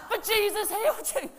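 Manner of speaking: high, loud protest through laughter; laughing protest. Retold or imitated. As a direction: cry it out while cracking up. Pastor protesting, laughing at convert attempt.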